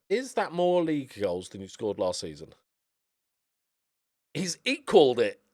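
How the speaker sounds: ordinary delivery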